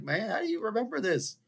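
high-pitched retold amazement; amazed reply. Regular customer to restaurant staff who remember him, amazed. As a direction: act out astonishment at being remembered.

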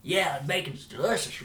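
hammed-up delighted guest; imitated eager friend. Voices friend praising the bacon.

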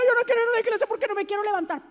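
quick, put-on little kid whine; whiny child. Mocking a child refusing church to a parent, whining. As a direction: whine like a lazy eight-year-old. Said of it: "cositas así de ocho o nueve años".

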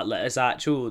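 ordinary talk

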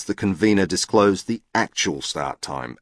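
usual speaking voice

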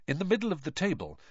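usual speaking voice

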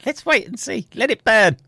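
mock villain voice. Mocking nuclear escalation joke.

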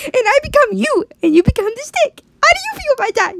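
very high, loud squeaky villain; shrieky little branch, triumphant; gloating talking branch. Acted. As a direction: squeal the threat with cartoon menace. Branch gloats over the deal.

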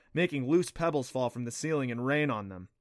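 ordinary delivery, nothing marked

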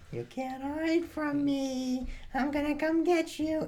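high, slow, soft singsong menace; drawn-out, high-pitched mock threat; ominous kid. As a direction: imitate a creepy child hunting someone. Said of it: "this kid's getting mad ominous".

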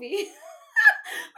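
very high, loud cackle; helpless high laugh; real cracking-up laugh. Real, not acted. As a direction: burst out in shrieking laughter. Cracks up at own texts.

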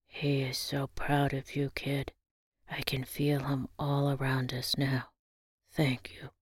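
put-on soft, low, hushed old man; emotional whisper. A narrator's reading. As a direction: whisper it, overcome. Granddad emotional about father.

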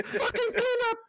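high, strained mock order; imitated kitchen tantrum; raging cook. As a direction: bark it as a comically furious cook.

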